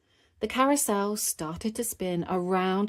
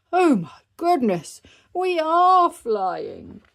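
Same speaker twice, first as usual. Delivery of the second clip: loud, high amazement, slowing at the end; startled, swelling wonder; astonished; 10 semitones higher, 9 dB louder, slower than usual. Acted. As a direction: exclaim it and stretch the last words. Daddy realizes he is flying.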